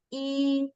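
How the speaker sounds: "E" said high and level as Mandarin first tone. Shown as a demonstration. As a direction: hold "E" on one high flat pitch. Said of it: "the high and flat pitch".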